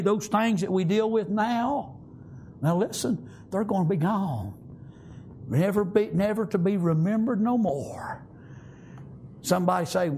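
ordinary delivery

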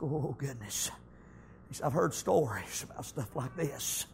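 soft, low, uneasy murmur to himself; worried murmur. Acted. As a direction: mutter the worry under your breath. David worries on the way.

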